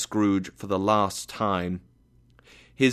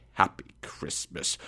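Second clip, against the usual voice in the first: low, hushed sneer; scornful echo; 6 semitones lower, 6 dB quieter than usual. A narrator's reading. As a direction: repeat the greeting under your breath with contempt. Scrooge echoes the greeting scornfully.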